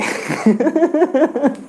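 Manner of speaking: short high burst of laughter; a quick high laugh at the question; amused laugh. Real, not acted.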